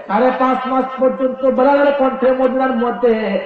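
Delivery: high, chanted wail to a husband; impassioned high-pitched outcry; crying out. Acted. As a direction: cry it out in preacher's singsong. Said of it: "মা ফাতেমা চিৎকার দিয়ে উঠলেন".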